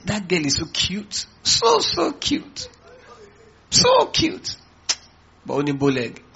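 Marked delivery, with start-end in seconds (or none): 0.0-4.5 squeaky high falsetto cooing; drawn-out, swooning falsetto gush; gushing admirer. Acted. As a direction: coo it in mock adoration, climbing very high. Mimicking someone gushing over a girl.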